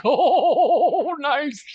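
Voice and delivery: high, laughing "oh" stretched out; gleeful reaction. Retold or imitated. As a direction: act out delight through laughter. Acted delight, laughing, at dad's stories.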